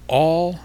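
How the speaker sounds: all punched high and loud; stress on all. Shown as a demonstration. Preacher stressing all to his listeners. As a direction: hit the word all hard. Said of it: "and I emphasize the word all".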